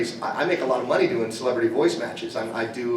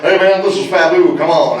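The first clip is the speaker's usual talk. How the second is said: [name] impression; 2 semitones higher, 9 dB louder than usual. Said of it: "I'm like".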